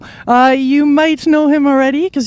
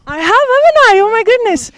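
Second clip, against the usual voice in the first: playful delight; 9 semitones higher than usual. Real, not acted. Playfully pleased at homework compliment.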